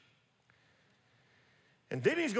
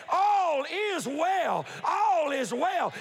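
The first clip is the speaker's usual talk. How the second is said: mother hollering back; 5 semitones higher, much slower than usual. Acted. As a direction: stretch every word, calling across the field. Mother hollers back in retold story.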